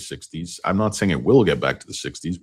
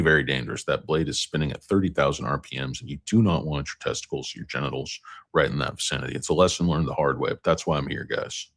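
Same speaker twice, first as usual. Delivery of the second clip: usual speaking voice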